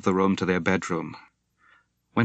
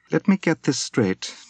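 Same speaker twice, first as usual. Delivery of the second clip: edge to her voice; 8 semitones higher than usual. A narrator's reading. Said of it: "she said with an edge to her voice".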